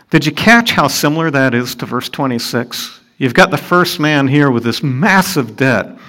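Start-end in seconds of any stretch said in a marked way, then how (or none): none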